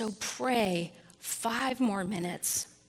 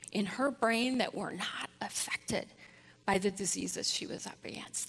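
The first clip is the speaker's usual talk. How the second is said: hushed, breathy telling; whisper; 4 dB quieter than usual. Real, not acted. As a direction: drop to a whisper. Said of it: "unvoiced whisper".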